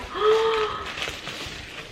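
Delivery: long, high gasp of delight; astonished gasp. Real, not acted. Fan sees a Beauty and the Beast gift, astonished gasp. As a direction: gasp as if freaking out over a gift. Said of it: "I'm freaking out right now".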